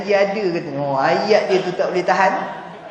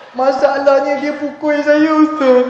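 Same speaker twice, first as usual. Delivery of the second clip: higher, louder mock whine of a husband; whining husband; 5 semitones higher, 5 dB louder than usual. To the ustaz, the husband whines about his problem. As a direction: plead like a man close to tears. Husband complains wife beats him.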